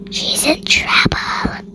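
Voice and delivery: whispered aside, voiceless and soft; stage whisper. A narrator's reading. Narrator warns listeners Lily's in trouble, whispering. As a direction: whisper it like a secret warning.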